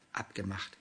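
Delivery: just nod it off softly; soft agreement. A narrator's reading. Anna promising not to laugh, quiet. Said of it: "willigte Anna ein".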